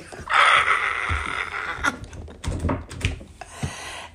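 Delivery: long wheezy laughing fit; real drawn-out cackle; real cackling laughter. Real, not acted. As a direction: crack up helplessly at your own joke. Cracks up at imagined reply.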